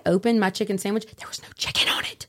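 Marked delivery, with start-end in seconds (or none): 1.2-2.3 hushed reveal. Real, not acted. Telling cohosts about the empty sandwich, hushed and dramatic. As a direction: drop to a breathy near-whisper for the punchline.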